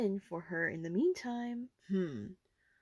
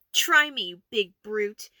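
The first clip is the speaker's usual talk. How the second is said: defiant taunt; 7 dB louder than usual. Acted. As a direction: challenge her to try. Fight, taunting the orc.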